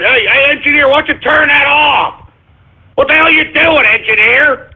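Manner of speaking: high-pitched, exasperated on-air outburst; irritated scolding. Real, not acted. Host to engineer to turn that off, irritated.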